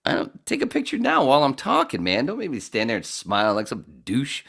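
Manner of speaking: a high mock shout at the photographer; mock hectoring. Comic joking at Greg taking pictures, high mock rant. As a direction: bark it high like a put-upon comic. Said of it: "in front of everybody going".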